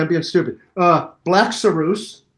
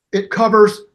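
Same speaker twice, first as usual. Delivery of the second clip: ordinary talk, nothing marked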